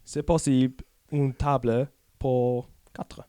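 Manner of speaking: put-on slow, careful French with a British accent; halting French. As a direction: pick through schoolboy French word by word. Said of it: "he definitely speaks French".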